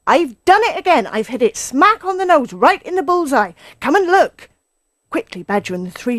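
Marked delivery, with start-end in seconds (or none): 0.1-4.5 loud, high, elated boasting; triumphant shout. A narrator's reading. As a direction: crow over a perfect hit. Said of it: "he shouted".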